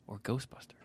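whispered; whisper yelling. Real, not acted. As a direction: breathe it out as a whisper. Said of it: "Why are we whispering?".

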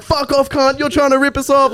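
high, aggressive yell, mock uncle. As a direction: send the driver packing. Uncles yelling at driver.

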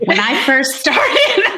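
high-pitched, drawn-out start, breaking into laughter; laughing false start. Real, not acted. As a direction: begin high and slow, tipping into a laugh. Jokingly races a sixty-second challenge.

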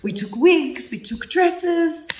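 put-on a big guy gushing as his inner girl; [name]'s girl voice. Burly man quoting his feminine side about cocktails and wigs, in a high mock girly gush. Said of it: "when he goes into his girl, it's fucking hilarious".

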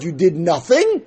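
ordinary talk

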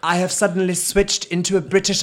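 high-pitched mock British accent; exaggerated fake British accent. Host mocking the actress's bad accent, exaggerated fake British. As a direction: lay on an obviously phony British accent. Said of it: "it wasn't a British accent".

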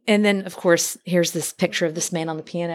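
usual speaking voice

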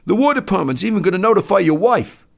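high, loud soldier's voice; enlisted man piling on. Acted. Enlisted man warning doctor about his wife, high and loud. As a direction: announce it loudly, pitched high.

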